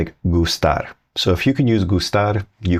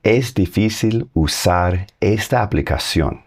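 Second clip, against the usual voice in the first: slow Spanish model. Shown as a demonstration. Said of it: "Try that".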